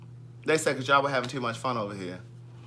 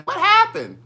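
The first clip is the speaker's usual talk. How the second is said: much higher, louder mock question; mocking viewer; 7 dB louder, slower than usual. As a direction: squeak it like the viewer. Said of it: "Dolores Hunt saying".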